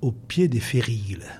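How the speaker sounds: slow, measured recitation with long pauses; poem reading. Acted. Guest reciting a Delteil-style truffle recipe on air, poetic reading. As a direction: read each instruction like a line of poetry.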